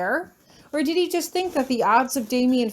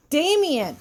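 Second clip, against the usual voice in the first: shout; 6 semitones higher than usual. Acted. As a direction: yell the name right at him. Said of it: "I shout in his face".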